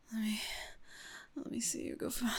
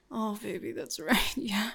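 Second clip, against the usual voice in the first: ordinary delivery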